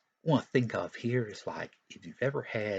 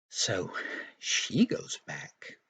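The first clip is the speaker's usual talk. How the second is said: ordinary talk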